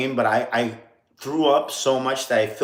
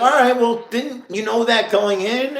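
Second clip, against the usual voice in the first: incredulous retort; 12 semitones higher, 5 dB louder than usual. Retold or imitated. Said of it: "And I was like".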